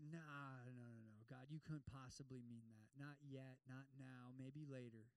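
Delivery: low, soft, dismissive mumble; mock reluctant mutter; mumbled reluctant excuse. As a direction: brush God off under your breath. Congregation's reluctant reply to God.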